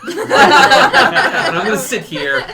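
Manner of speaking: words stretched by laughter; laughing. Real, not acted. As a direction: talk through real laughter. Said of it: "laughs while telling".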